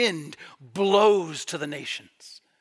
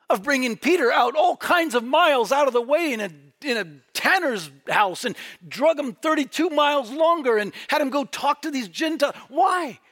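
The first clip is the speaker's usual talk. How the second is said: higher and louder, shouting the sermon home; full-force preaching; 7 semitones higher, 4 dB louder than usual. Acted. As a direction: preach it out with rising fire.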